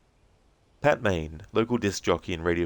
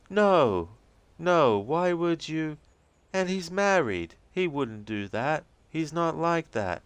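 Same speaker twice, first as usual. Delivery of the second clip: high, insistent Rose; 10 semitones higher, slower than usual. Acted. Rose denying the affair to the agent, high and insistent. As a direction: protest it, shocked at the idea.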